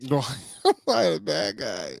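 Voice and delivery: high, whiny repeat; mock whiny echo. Husband mockingly echoing his wife's complaint, high whine.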